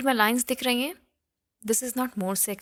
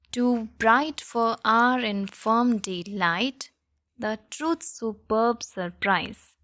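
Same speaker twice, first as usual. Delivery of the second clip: slow verse, "truth" and "slant" beaten as stressed; metrical recitation; much slower than usual. Shown as a demonstration. Teacher reciting a poem to show the stressed syllables, metrical recitation. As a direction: scan the poem slowly, foot by foot, unstressed then stressed. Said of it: "we will recite it like this".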